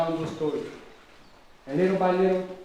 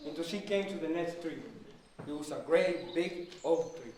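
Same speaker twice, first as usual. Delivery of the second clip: usual speaking voice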